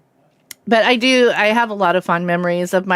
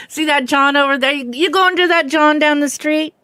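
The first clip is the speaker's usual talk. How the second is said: put-on Philly accent; 6 semitones higher than usual. Streamer teasing a Jersey viewer in a mock Philly accent. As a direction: tease the Philly John like a local. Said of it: "Y'all use John for everything".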